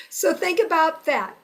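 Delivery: normal talking voice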